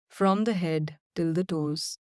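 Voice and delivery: ordinary talk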